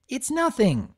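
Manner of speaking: high, chirpy forced cheer; high nervous chirp. A narrator's reading. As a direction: chirp it through a twitching smile. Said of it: "chirped with a twitching smile".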